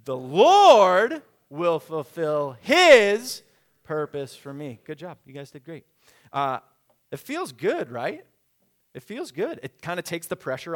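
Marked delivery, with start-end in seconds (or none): 0.0-4.8 slow, very loud, with "Lord" and "his" drawn out high; stress on Lord and his. Shown as a demonstration. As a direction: lead the crowd, belting long on "Lord" and "his". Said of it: "One more time".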